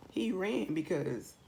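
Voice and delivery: ordinary delivery, nothing marked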